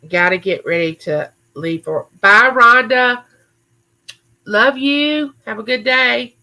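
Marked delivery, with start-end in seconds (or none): 2.2-6.4 bye, Rhonda, called out higher and louder; affectionate goodbye call. Real, not acted. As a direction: call a loving farewell across the house. Calling goodbye to someone leaving.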